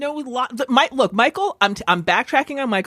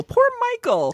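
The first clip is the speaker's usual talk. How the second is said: much higher, exaggerated pity; mock pity. Real, not acted. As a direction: sing it up high in mock sympathy. Repeats with exaggerated sympathy.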